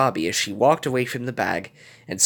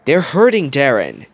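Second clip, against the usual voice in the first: higher, louder retort; spat out; 5 semitones higher, 7 dB louder than usual. A narrator's reading. Gogo tells Darren the others are hurting, spitting it out. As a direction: spit it out, mildly angry. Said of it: "Gogo spat".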